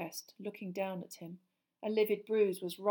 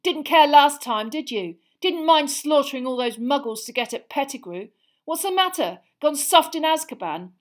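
raised, loud accusing questions; angry taunting; 7 semitones higher, 11 dB louder than usual. A narrator's reading. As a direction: hurl the accusations at the enemy. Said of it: "Harry spat".

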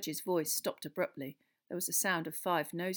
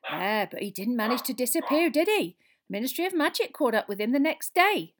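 gloating a little, voice climbing; satisfied; 6 semitones higher, 10 dB louder than usual. A narrator's reading. Landlady reminds them he was caught, satisfied. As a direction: score the point with relish. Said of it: "said with some satisfaction".

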